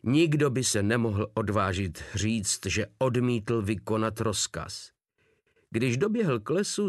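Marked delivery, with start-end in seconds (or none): none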